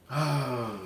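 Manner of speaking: audible breath into a contented "ah"; savoring sigh. Acted. Smelling onions and mushrooms in butter, savoring sigh. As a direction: breathe in, then sigh with pleasure.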